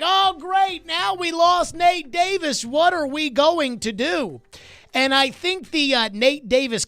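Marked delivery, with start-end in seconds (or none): 0.0-4.4 higher, slow exasperated lament; drawn-out, higher-pitched mock despair; fans' mock dismay. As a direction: whine it like a panicking fan. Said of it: "A lot of people are like".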